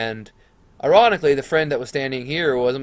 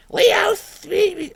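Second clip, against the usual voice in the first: very high mimicked PA squawk, louder; intercom voice; 5 dB louder than usual. Intercom calls him in the gym, squawky imitation. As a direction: imitate a garbled intercom calling a name. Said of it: "a little intercom inside the gym".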